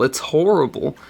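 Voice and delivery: ordinary delivery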